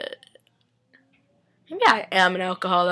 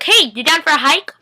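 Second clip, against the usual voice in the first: higher, louder peppy offer; cheery invite; 7 semitones higher, 6 dB louder than usual. Retold or imitated. Elena inviting Rick on a hike, peppy mock voice. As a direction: pitch the hike brightly. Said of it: "Elena goes up to Rick".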